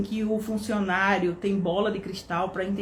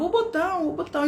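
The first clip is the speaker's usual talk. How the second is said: mild mock yell, much higher pitch; retold shouting; 9 semitones higher, faster than usual. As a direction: imitate an insistent manager calling out, voice raised high. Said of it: "e começou a gritar".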